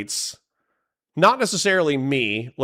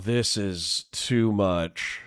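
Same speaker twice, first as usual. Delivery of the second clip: usual speaking voice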